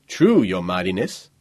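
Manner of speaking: lightly voiced, respectful bodyguard; deferential bodyguard. Bodyguard confirming to the boy Anarch, deferential. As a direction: confirm it respectfully to your young master.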